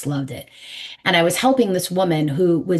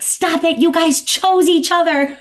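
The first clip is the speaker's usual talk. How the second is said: much higher, scolding mom breaking up a fight; exasperated parent scolding; 11 semitones higher, 5 dB louder than usual. Pretend. Mother telling her fighting children to stop, high scolding. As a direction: snap at bickering kids like a fed-up mother. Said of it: "you'd be like".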